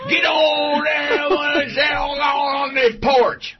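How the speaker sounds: very high, drawn-out garbled shout; hollering Southern father. Acted. Imitating roommate's father from Virginia, slurred high holler. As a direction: holler slowly across the yard, hammed up. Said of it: "my college roommate's father from Richmond, Virginia".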